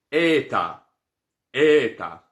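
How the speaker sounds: eta with open E, first syllable stressed; pronunciation demo. Shown as a demonstration. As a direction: say eta crisply. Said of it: "esse é eta".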